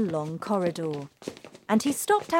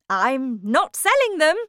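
firm refusal; 8 semitones higher, 8 dB louder than usual. Acted. Wonka firmly refuses to sell.

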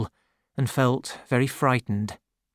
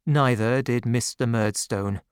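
plain reading voice

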